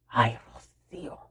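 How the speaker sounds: low whispered 'Ay, Rocío'; whispered sigh. Retold or imitated. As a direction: breathe it out as a defeated whisper. Dad sighing in quoted reply.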